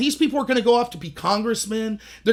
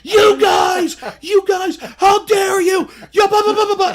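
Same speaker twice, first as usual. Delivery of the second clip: high, loud mocking shriek of outrage; hammed-up furious shouting; mock screaming; 10 semitones higher, 8 dB louder, slower than usual. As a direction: screech it like an outraged crowd. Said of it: "They start screaming".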